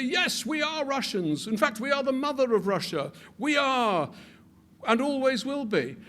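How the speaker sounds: high, loud, rallying proclamation; imagined Ukrainians proclaiming. Retold or imitated. Lecturer voicing Ukrainians rising up, in a high loud proclaiming cry. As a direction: cry it out like a crowd declaring allegiance. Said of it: "would suddenly rise up and say".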